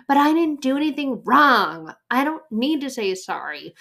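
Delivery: put-on high, loud childish protest. Person refusing to apologize, high whiny child's protest. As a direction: protest like a child who's been told off. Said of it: "feeling like we're a child again".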